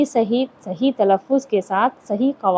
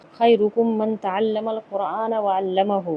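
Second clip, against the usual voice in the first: recitation; 3 dB quieter, slower than usual. Acted. Hadith recited in Arabic.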